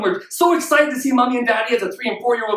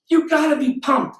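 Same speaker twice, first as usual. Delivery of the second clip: usual speaking voice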